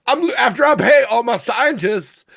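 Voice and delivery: very high-pitched character impression; exaggerated high voice, played for laughs; Baby Billy. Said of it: "the Baby Billy voice".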